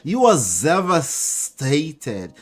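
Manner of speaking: put-on slow, drawn-out Bronx accent. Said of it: "What is that, like a Bronx accent?".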